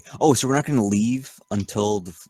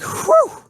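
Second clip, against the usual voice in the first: relieved whew; 8 dB louder than usual. Acted. Relieved exhale in character.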